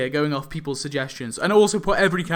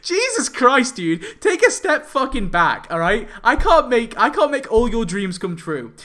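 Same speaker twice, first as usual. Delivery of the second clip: louder and higher, stretched out in exasperation; exasperated rant; 7 semitones higher, 5 dB louder than usual. Real, not acted. Youtuber snapping at his angry commenters, exasperated loud rant. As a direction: tell the commenters to back off, fed up.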